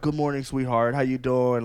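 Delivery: mocking the texter. Boyfriend imitating guy texting his girl, mock smooth. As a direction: sneer it like a sleazy charmer. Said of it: "he would text her".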